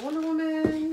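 thrilled at the find; delighted opening a present. Real, not acted.

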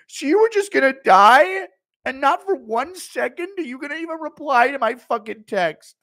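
high, loud offended whine; Schmitty impression. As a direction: squeak out the hurt of being left out. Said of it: "Impression of Schmitty after not getting invited".

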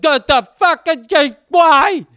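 high, loud mock panic; startled yell. Napper woken by a screaming cat, startled mock yell. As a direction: cry out as if jolted from sleep. Startled awake by the cat's meow.

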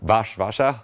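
Warsaw as a questioning "VAH-sha"; attempted Polish pronunciation. Shown as a demonstration. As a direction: check the local "Warsaw", V at the front, sh inside. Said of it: "How do you pronounce Warsaw?".

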